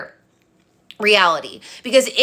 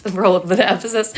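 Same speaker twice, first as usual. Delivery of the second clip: laughing through the words. Real, not acted. Coach laughing at her own tapping nails. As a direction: giggle at the nail tapping while talking. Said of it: "My nails are tippity-tapping".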